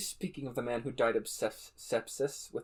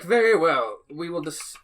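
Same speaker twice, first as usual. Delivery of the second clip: judge voice; 4 semitones higher, 9 dB louder than usual. Acted. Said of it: "He's like".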